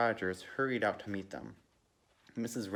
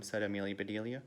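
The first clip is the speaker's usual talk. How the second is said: plain reading voice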